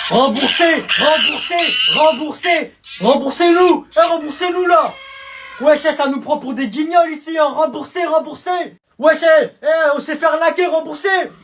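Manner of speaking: loud, high chanted demand; angry protest. Acted. Cheated zoo visitor demanding money back, shouted protest.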